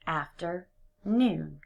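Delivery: slow, "afternoon" in separate parts; split slowly. Shown as a demonstration. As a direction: drag "afternoon" apart. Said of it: "break it apart into two separate words".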